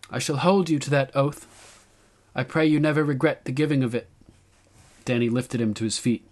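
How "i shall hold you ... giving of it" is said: high queenly voice; young queen's formal reply. A narrator's reading. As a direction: answer a vow formally, pitched up.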